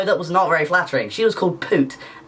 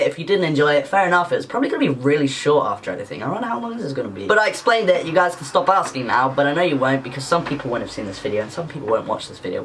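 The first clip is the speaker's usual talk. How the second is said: usual speaking voice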